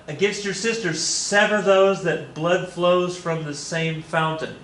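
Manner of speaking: high put-on lady, slowing down; lady's voice. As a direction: plead it formally as the lady, drawing out the end. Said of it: "And she says".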